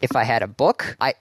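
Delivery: ordinary delivery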